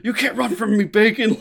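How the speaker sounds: high, loud mock threat; retold shout. Speaker acts out a character chasing Bacon, mock shout. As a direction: yell it as an imitated pursuer.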